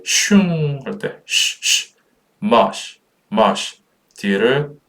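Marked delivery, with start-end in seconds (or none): 0.0-0.8 long whooshing "shyung" falling from high pitch; wind sound. Shown as a demonstration. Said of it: "바람 소리". 1.3-1.9 "쉬" voiceless, air only; two separate hushes on "쉬", like wind; hushing sh sound. Shown as a demonstration. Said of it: "바람 소리".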